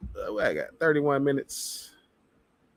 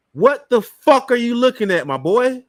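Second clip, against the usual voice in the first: angry outburst; 7 semitones higher, 6 dB louder than usual. Real, not acted. Ranting at a conspiracy video.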